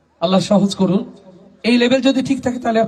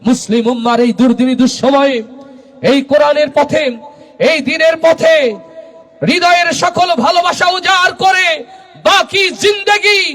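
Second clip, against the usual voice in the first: preaching at full force; 7 semitones higher than usual. Real, not acted. Preacher urging the crowd to give their life to the Quran's path, building to a shout. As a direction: climb phrase by phrase into a full-throated call.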